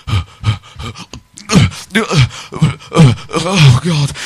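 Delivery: heavy panting between words; mock out of breath. Comic acting fat ghost hunters on the stairs, exaggerated panting. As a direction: wheeze as if hauling yourself upstairs.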